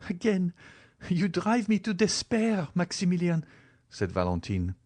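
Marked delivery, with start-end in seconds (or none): 0.1-3.4 higher, anguished voice; despairing girl. Acted. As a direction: let the despair rise. Valentine in despair.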